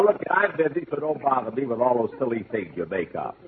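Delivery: ordinary talk, nothing marked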